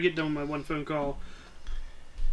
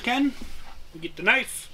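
ordinary delivery

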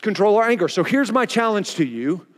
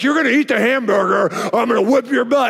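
mock stern father's threat; lightly voiced dad; 2 semitones higher than usual. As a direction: imitate a dad laying down the law. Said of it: "My dad's like".

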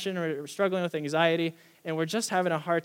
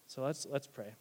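normal talking voice

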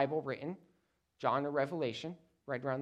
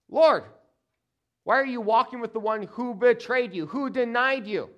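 raised, louder indignant challenge; accusing swipe; 6 semitones higher, 6 dB louder than usual. Acted. John takes a swipe at Peter before the Lord, accusing. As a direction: protest loudly and high, taking a swipe at a rival. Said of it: "And John takes a swipe".

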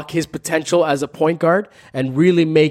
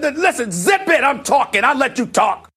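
high, sharp playful scolding; mock scolding; 10 semitones higher than usual. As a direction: shush him with fake outrage. Host cutting off a panelist.

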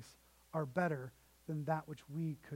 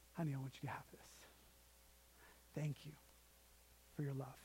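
low, soft fatherly voice; hushed, grateful father to his daughter; tender giving; 5 semitones lower, 14 dB quieter, faster than usual. Retold or imitated. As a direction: offer it quietly and lovingly, with pauses. Dad gives the real pearls.

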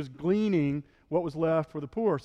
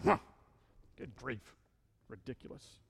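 scornful snort; 4 semitones lower, 10 dB quieter than usual. Retold or imitated. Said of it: "they snorted at, kind of a snort".

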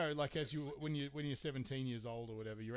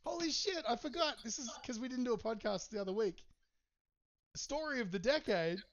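excited; 11 semitones higher, 9 dB louder than usual. Real, not acted. Sudden excited recall.

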